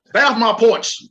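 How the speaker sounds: put-on loud grumpy old man yell; shouting at trespasser. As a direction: yell it at kids on your lawn. Old man yelling at kids.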